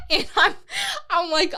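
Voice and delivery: high, laughing; cracking up mid sentence. Real, not acted. Laughing while telling friends about the race.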